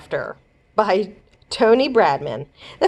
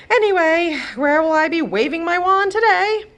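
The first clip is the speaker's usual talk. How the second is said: much higher, louder weary fairy; godmother voice; 10 semitones higher, 4 dB louder than usual. Acted. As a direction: ask grumpily about work.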